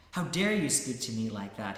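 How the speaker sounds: loud offended outcry; outraged cry. Acted. Insulted man confronting the saint, loud outraged cry. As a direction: hurl it back at the saint, stung. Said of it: "he cried".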